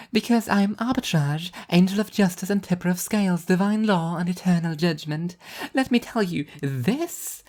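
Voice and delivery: high, bright cheerful angel voice; an upbeat angel showing off her plan; high, cheerful divine woman; cheerful angel Arbitrage. A narrator's reading.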